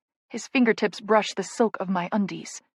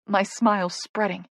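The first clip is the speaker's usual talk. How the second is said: usual speaking voice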